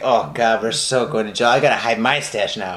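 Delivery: low-pitched mock dread; dismayed boyfriend. Boyfriend seeing the body on the lawn, mock dismay. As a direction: lightly act out a low, sinking realization.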